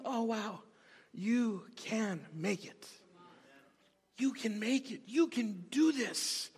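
soft, slow reassurance; gentle encouragement. Acted. Preacher acting out encouraging someone he's talking with, gently. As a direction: encourage them quietly, taking your time.

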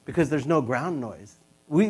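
ordinary delivery

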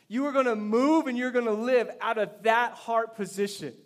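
loud, high-energy preaching. Real, not acted. Pastor urging church to live under the King's authority, forceful preaching. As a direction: drive it home to the congregation with force.

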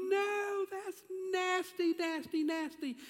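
put-on high, drawn-out little-kid protest; little girl. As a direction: whine it slowly like a disgusted child. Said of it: "somebody like Skylar saying".

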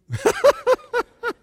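real high, loud laugh; amused laugh. Real, not acted. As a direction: burst out laughing at a funny line. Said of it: "This is what's funny".

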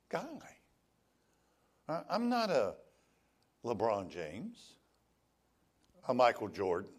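ordinary talk

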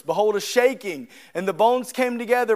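normal talking voice

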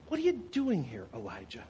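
a hushed, gentle divine question; God's quiet question. Acted. God softly asks Elijah why he is there, hushed. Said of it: "he just poses a simple question".

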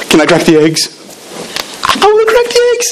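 put-on high, squeaky kid voice asking to crack eggs; eager kids begging to help. Kids at the mission begging to crack eggs, in a high squeaky voice. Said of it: "they're like".

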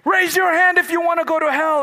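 loud high preacher shouting at the crowd; preacher's loud call. Retold or imitated. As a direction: bellow the altar call. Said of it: "And then we would all sit there".

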